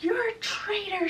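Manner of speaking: high-pitched retold accusation; mock scream. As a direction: screech it without full volume, as an enraged mother. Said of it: "Lady Tremaine is screaming".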